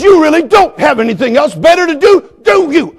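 high, loud retold taunt; worked-up retort. Pastor reliving the dog owner's retort, loud and worked up. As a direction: fling the question back at high pitch.

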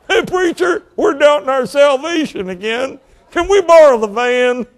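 high, laughing wheedle of a sly usher; mock usher. Joking ushers begging the pastor for the van, high and laughing. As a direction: ask sheepishly for the van, grinning.

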